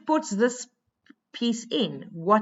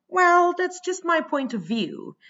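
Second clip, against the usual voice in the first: a lightly voiced poet, pitched much higher; voicing the poet; 9 semitones higher than usual. Teacher voices the poet's opinion for her class, pitched higher. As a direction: give the poet's words a lifted, higher voice. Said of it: "So he's saying".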